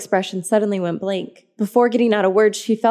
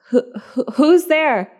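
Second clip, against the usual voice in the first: scared stuttering voice; stammering; 7 semitones higher, 4 dB louder than usual. Acted. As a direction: stammer with fear. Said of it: "I stammered".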